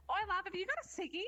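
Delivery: high-pitched Australian bogan pester; bogan accent. Retold or imitated. Bogan begging at a train station, high-pitched bogan accent. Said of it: "basically what it sounds like is".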